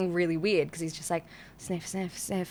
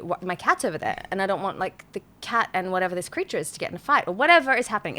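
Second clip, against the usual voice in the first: ordinary talk, nothing marked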